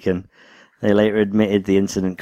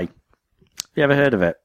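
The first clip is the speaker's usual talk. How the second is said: ordinary talk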